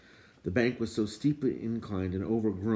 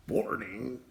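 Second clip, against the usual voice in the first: indignant cry; 4 semitones higher than usual. A narrator's reading. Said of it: "cried the dwarf".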